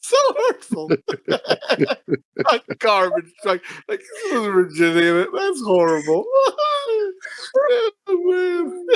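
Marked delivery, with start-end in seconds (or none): 0.0-1.3 much higher through laughter; laughing. Real, not acted. Laughing at the garbage truck joke, high laughing squeal. 6.3-8.0 much higher cackling laughter; high shrieking laugh. Real, not acted. Cracking up over the pig joke, high shrieking laughter.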